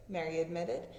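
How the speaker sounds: usual speaking voice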